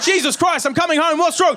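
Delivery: high, alarmed shouted reply; panicked son. Acted. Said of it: "put the fear of God into my heart".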